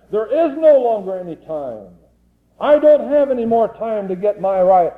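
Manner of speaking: high, urgent revival preaching; fiery preaching. Acted. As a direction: drive it home to the congregation, pleading and pressing. Preaching at full force.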